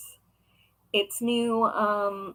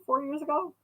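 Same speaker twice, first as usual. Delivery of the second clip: normal talking voice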